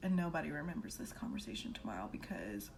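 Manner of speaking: soft whisper; hushed whisper hoping nobody remembers; whispered hope. Retold or imitated. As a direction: whisper it, praying they all forget. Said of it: "I was like".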